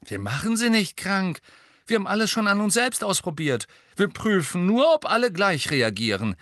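high, quick-fire justification; defensive protest. A narrator's reading. Twin justifying the testing to Hermine, defensive. Twins argue back.

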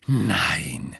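low, forceful refusal; quivering with anger. A narrator's reading. Hermine drawn up to full height, contained anger. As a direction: hold the fury down low. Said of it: "sagte sie mit zornbebender Stimme".

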